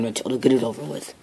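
ordinary delivery

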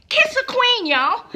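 high, loud call to kiss a queen; excited cheer. Real, not acted. Vlogger urging viewers to kiss drag queens, excited cheer.

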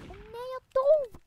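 high, loud wail of defeat; dismayed cry. Real, not acted. Player just killed in the game, loud high dismayed cry. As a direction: cry out at losing the round.

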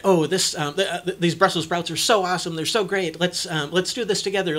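high, loud, fast gushing; overdone enthusiasm. Pretend. Parent modeling joy to the kid, hammed-up excitement. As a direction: sell the Brussels sprouts like the best thing ever. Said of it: "your enthusiasm".

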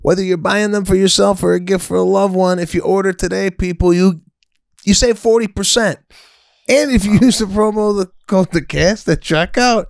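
high, loud promo pitch; over-excited sponsor announcement; hyped-up ad read. Acted. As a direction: sell the offer high and excited. Performs the ad offer.

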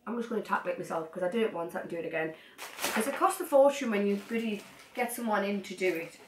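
ordinary delivery

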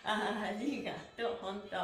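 ordinary talk

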